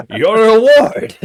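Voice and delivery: loud, high triumphant cry; grand proud announcement. Acted. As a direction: proclaim it like a treasure gift. Mouse character announces reward.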